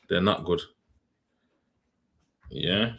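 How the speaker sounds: usual speaking voice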